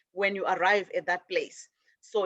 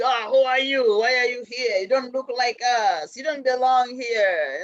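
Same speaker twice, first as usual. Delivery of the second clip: louder, higher, suspicious demands; hostile challenge; 4 semitones higher, 5 dB louder than usual. Acted. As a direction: sneer at the stranger who doesn't belong. Others challenge you at station.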